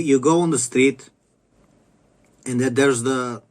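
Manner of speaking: ordinary delivery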